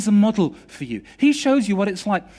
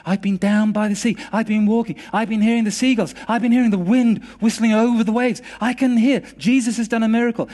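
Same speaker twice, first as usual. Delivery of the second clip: raised, building excitement; elated report, louder and higher toward the end; joyful testimony; 4 semitones higher, 4 dB louder than usual. Retold or imitated. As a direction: pile up each discovery with growing wonder. Healed man's joyful report.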